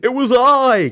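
high, loud theatrical announcement; triumphant cry. Acted. Man jumping out from behind sofa, crying out. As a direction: cry out with a flourish. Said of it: "cried a man".

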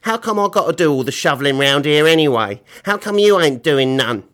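loud put-on rough voice; indignant complaint. Billy complaining to Mister Gum, loud and indignant. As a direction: protest at top volume. Billy protests after being burned.

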